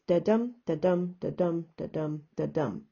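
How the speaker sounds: five beats of weak "da", strong "dum"; iambic rhythm. Shown as a demonstration. Teacher counting five iambs for students, iambic rhythm. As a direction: chant "da dum" evenly, stepping down in pitch. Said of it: "each iamb is da-dum".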